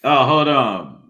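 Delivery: ordinary talk, nothing marked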